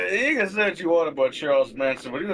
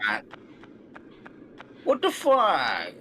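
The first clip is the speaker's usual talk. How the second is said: high, slow, falling cry of disbelief; exasperated disbelief; 7 semitones higher than usual. Real, not acted. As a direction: stretch it out, starting high and sliding down. Streamer complains about tiny audience.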